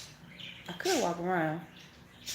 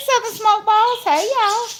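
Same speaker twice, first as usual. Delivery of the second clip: much higher and louder call-out; sing-song greeting; 12 semitones higher, 8 dB louder than usual. Real, not acted. Greeting a viewer mid-sentence, high loud sing-song hello. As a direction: shout the name up high.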